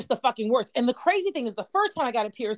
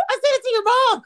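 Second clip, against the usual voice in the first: high, loud wail; retold frantic blurting, pitched way up; panicked confession; 13 semitones higher, 6 dB louder than usual. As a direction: cry it out in mock horror. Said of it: "like, hyperventilating".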